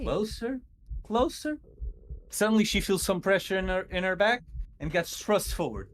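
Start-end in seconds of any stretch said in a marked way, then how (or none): 0.0-1.6 ghost calling. Acted. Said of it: "the ghost says".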